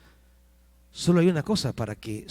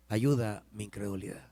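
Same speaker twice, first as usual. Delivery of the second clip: low, soft quoted plea; súplica en voz baja; 6 semitones lower, 8 dB quieter than usual. Acted. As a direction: plead quietly, low in the voice. Quotes the cry as quiet prayer. (súplica suave, en voz baja)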